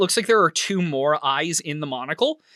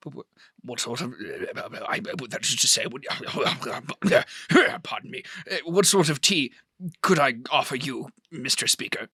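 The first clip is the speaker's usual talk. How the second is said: flustered wizard; 4 semitones lower, faster than usual. Acted. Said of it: "a little flustered".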